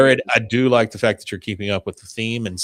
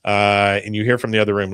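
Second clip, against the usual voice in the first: ordinary delivery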